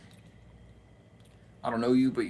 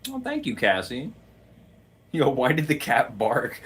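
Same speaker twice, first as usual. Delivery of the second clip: ordinary talk, nothing marked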